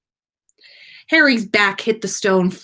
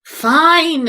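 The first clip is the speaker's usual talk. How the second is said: groaning; 9 semitones higher, 6 dB louder than usual. A narrator's reading. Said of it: "Harry groaned".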